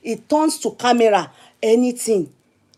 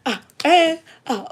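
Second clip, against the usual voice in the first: mock helpless reply; 6 semitones higher than usual. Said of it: "I said".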